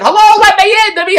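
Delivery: very high, loud mock holler; imitated hollering. Helper hollering to an old father to get in, mock shouting. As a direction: holler as if to someone hard of hearing. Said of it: "how they hollered that".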